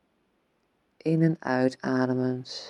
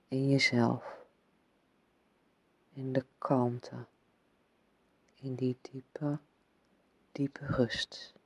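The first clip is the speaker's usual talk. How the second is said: whispering; 7 dB quieter than usual. Acted. Deep rest, fading to whisper.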